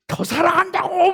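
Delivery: high, loud yell of love; shouted at wife. Retold or imitated. Husband shouting at his wife in a quarrel, loud yell. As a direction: yell it out in frustration. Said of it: "막 소리 지르려고 하지".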